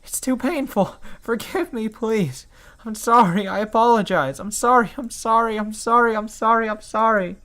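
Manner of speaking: high, tearful begging apologies; breaking down in tearful pleas; crying shamelessly. Acted. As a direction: sob out the apologies over and over. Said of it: "I start crying shamelessly".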